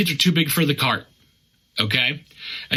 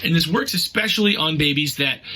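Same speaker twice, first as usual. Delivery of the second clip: ordinary delivery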